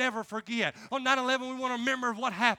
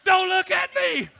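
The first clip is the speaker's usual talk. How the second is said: yelling drill instructor; 6 semitones higher, 6 dB louder than usual. Retold or imitated. Drill instructor berating a staring recruit, shouted imitation. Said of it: "He'd be yelling and screaming".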